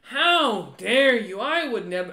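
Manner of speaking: high, loud, drawn-out indignation; affronted noble. Acted. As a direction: protest like an insulted noble, stretching the words. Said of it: "And the noble goes".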